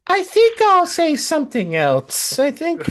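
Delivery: very high mock voice; squeaky high imitation; imitating Ashley. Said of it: "Ashley was like".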